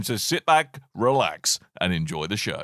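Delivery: low, softening show-host voice; soothing sign-off. Acted. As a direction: ease the listener down as you go.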